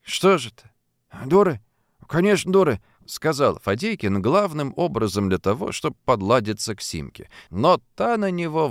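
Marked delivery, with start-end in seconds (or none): 0.0-2.8 higher, lively common speech; a folksy young voice; voiced townsfolk. A narrator's reading. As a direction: play the characters chatting. Simka and Fadeykin voiced.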